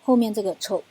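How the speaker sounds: ordinary talk, nothing marked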